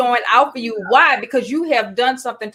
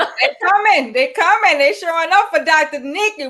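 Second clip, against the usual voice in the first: excited; 7 semitones higher than usual. Real, not acted. Excited greeting of fans.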